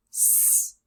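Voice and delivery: "s" as a sustained hiss; long "sss" for the letter "s"; isolated sound. Shown as a demonstration. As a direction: hiss "s" on its own. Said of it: "look at the fourth syllable".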